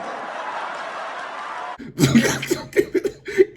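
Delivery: long, wheezy high laugh; real laugh. Real, not acted. Reactor hearing the remembered joke, laughing hard. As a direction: crack up at the joke.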